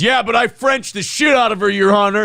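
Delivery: hammed-up courtroom boast; proud mock shout. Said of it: "And Ashton goes".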